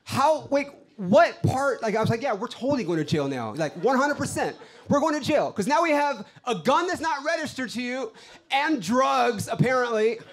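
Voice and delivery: high, loud, incredulous outburst; exasperated rant. Acted. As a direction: rant in disbelief at your girlfriend. Acted outburst after unregistered gun news.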